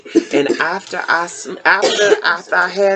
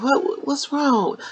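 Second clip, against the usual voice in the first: son's voice; 8 semitones higher, slower than usual. Retold or imitated. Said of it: "He said".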